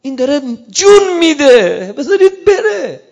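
high, loud, crying out; preacher voicing Imam Hussein crying out, loud and tearful; tearful shout. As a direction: shout through tears to let him go. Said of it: "داد زد امام حسین".

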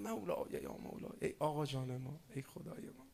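soft, intimate mystical call; mystical whisper-soft. Retold or imitated. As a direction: murmur it gently like a mystic in prayer. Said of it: "یه وقتایی باید اینو عرفانی گفت".

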